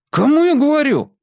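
high sharp shout. A narrator's reading. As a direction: bark it at the boy. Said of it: "прикрикнул Генка".

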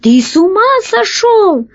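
very high, incredulous cry; indignant shout. A narrator's reading. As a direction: cry out in disbelief at a mad plan. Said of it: "закричал из люка крыс". (возмущённый высокий крик)

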